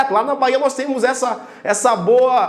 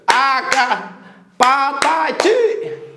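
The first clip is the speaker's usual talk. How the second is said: slow, measured chanting of each syllable; much slower than usual. Shown as a demonstration. Teacher showing the clap method fails, drawn-out syllables. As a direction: beat out pa-ta-ti as if clapping. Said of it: "Você vai bater palma".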